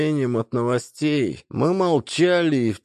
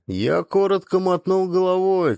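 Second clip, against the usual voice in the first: ordinary delivery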